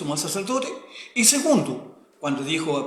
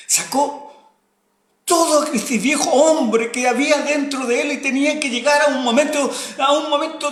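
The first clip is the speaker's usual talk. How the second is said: raised, forceful sermon voice; loud fervent preaching; 7 semitones higher, 8 dB louder than usual. Acted. Preacher pressing the congregation with raised voice. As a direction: drive the point home to the congregation.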